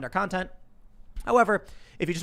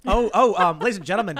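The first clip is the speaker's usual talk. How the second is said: loud, raised-pitch mock show announcement; mock announcer; 4 semitones higher, 9 dB louder than usual. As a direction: announce the stunt with mock importance. Mock-grand announcement of a stunt.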